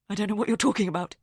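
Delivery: quick, defensive denial; flustered denial. A narrator's reading. Rosamond fires back a quick defensive denial. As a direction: brush it off fast.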